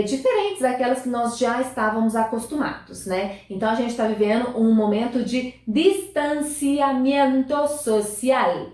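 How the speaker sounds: usual speaking voice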